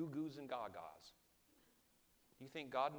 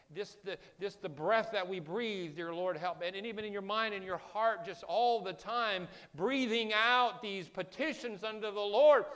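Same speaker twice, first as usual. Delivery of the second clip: louder, higher fervent sermon delivery; full-force preaching; 6 semitones higher, 8 dB louder than usual. Acted. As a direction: preach it with rising fervour.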